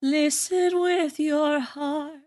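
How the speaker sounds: put-on slow, high, wise old tree voice; a slow and mystical elder; Grandmother Willow voice. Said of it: "you have Grandmother Willow".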